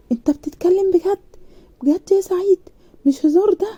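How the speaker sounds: high, excited whisper of delight; father can't believe the good news; joyful disbelief. A narrator's reading. Father to son Saeed, whispering in excited joy. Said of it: "علي بفرحة".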